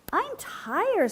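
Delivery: high tired little frog. A narrator's reading. Third young frog complaining in a high voice. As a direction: whine it high and small. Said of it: "said the third".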